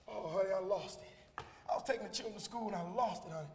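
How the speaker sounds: a lightly voiced wife confessing; apologetic wife. A wife telling her husband she lost the money, soft.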